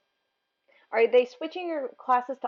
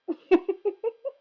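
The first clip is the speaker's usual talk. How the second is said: laugh; 10 semitones higher, 6 dB louder than usual. Real, not acted. Laughs at own name joke.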